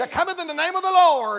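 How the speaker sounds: quick rallying call of praise; acted crowd acclamation; crowd yelling. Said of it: "yelling to the top of their voice".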